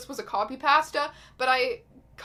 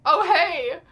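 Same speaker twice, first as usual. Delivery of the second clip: loud, higher-pitched awkward greeting; caught out; 4 semitones higher, 9 dB louder, much slower than usual. Retold or imitated. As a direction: act busted, with a pause after the first word. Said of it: "When people call me out".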